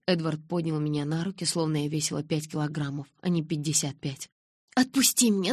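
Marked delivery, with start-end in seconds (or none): 4.7-5.5 yelling. A narrator's reading. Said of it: "закричала я".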